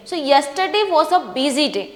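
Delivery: slow, clear read with a falling end at the full stop; reading with proper intonation. Shown as a demonstration. Teacher reading a paragraph aloud for students, slow with clear intonation. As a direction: read it slowly, letting the voice drop at the full stop. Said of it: "proper intonation का use करके".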